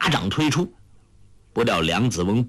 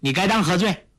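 put-on higher, demanding girl's challenge; stern shout; 7 semitones higher, faster than usual. A narrator's reading. Huang Rong demands his guilt, high and stern. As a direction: bark the question at him. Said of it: "喝道".

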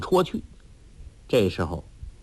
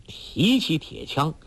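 ordinary delivery

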